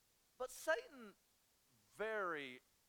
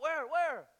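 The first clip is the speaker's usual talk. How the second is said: high, loud alarmed yell; mock alarm; 11 semitones higher, 12 dB louder than usual. Husband mimics his own jumpy alarm, high loud yell. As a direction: jump in hunting for the bug. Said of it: "I'm like".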